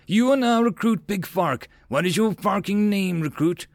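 high-pitched berating; screaming instructor, high in pitch; drill officer's yell. A narrator's reading. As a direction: rename the recruit with parade-ground force.

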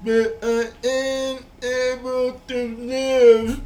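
high, slow deaf person voice; slow, high imitation of a deaf speaker. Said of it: "I'm trying to sound like a deaf person now".

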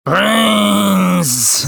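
groaning zombie cry for brains. Pretend. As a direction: moan it like a hungry zombie. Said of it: "You just love saying that".

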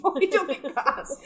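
high, loud, laughing retort; laughing mock protest. Founder answering lady about making bras, laughing mock protest. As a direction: protest loudly through laughter. Acting out her own amused reply.

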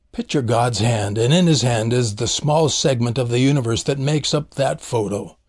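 ordinary talk, nothing marked

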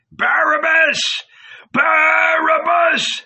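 very high, loud drawn-out howl; crowd howling. A narrator's reading. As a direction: howl the name like a mob. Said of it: "howled the people".

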